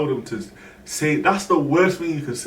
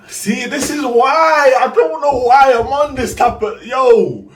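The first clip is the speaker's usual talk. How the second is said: excited rant; 9 semitones higher, 5 dB louder, slower than usual. Real, not acted. Hyped aside about the story.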